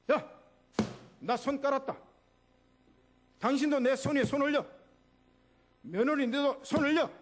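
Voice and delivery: loud, high call to join in; rally the family with urgent orders; rousing command. Acted. Father-in-law calling family to stack hands, loud and commanding.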